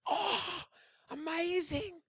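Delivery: high, soft wonder; acted amazement. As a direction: imitate an awestruck crowd, high and hushed. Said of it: "the people just respond, and they're like".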